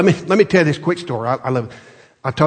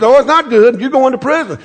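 higher, louder furious retort; king snapping at his servant, furious; angry king; 10 semitones higher, 6 dB louder than usual. Retold or imitated. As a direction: shout down the servant. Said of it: "He said".

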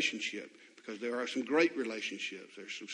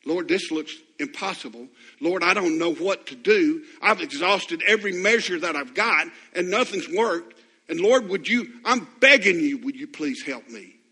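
pleading prayer growing louder toward I'm begging you; desperate kneeling plea; 5 semitones higher, 11 dB louder than usual. Pretend. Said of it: "get on your knees and say".

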